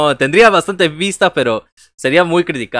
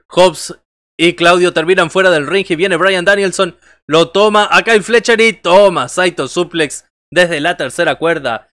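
narración en vivo, más fuerte; narrador de lucha libre; 4 dB louder than usual. Acted. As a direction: cuenta la acción del ring con energía. Narrates the match live. (narrador de lucha libre)

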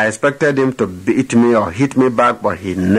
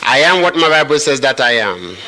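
preaching at full force; 5 semitones higher than usual. Acted. Preacher leading the congregation against the devil, full-force proclamation. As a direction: proclaim it like a battle cry to the devil.

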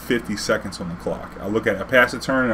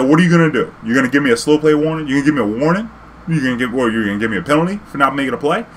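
higher, louder sarcastic challenge; defiant challenge; 3 semitones higher, 4 dB louder, faster than usual. Retold or imitated. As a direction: dare the judge to penalize. Challenging the judge.

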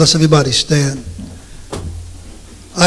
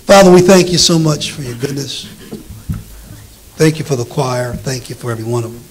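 prayer; 4 semitones lower, 5 dB quieter than usual. Acted. Leads the congregation in prayer.

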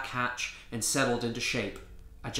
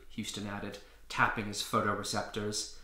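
ordinary delivery, nothing marked

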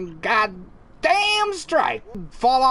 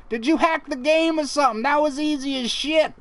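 ordinary talk

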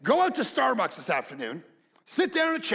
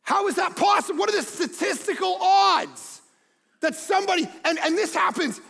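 incredulous outburst; 9 semitones higher, 3 dB louder than usual. Retold or imitated. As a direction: exclaim in amazed disbelief. Reacting to unlikely offer in story.

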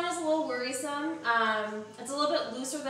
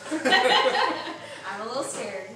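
laughing; 4 dB louder, much slower than usual. Real, not acted. Nervous laugh before tasting.

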